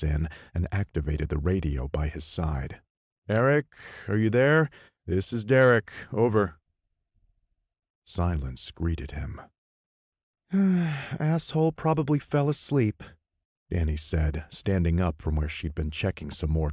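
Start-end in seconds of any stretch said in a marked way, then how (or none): 3.3-6.6 calling over the radio; radio call, raised voice. Acted. 10.5-13.0 higher scoffing woman. Acted. Danny scoffs at absent Eric.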